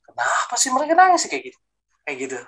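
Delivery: retold unease, pitched high; uneasy. Newcomer watching worshippers cry, retold as uneasy. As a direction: wonder aloud in a high, uneasy voice. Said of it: "saya merasa takut, gelisah".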